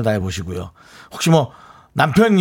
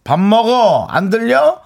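louder, higher call across the house; 8 semitones higher, 4 dB louder, much slower than usual. Retold or imitated. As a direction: yell dinner's ready from far away. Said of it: "라고 소리 질러야 들을 수 있는".

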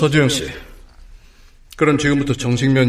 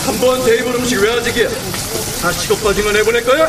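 harsh barking commands; 7 semitones higher than usual. Acted. Chef yelling in busy kitchen.

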